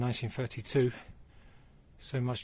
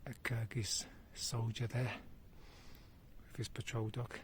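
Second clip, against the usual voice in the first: whispering; 9 dB quieter than usual. Real, not acted. Soldier nearby, speaks under breath.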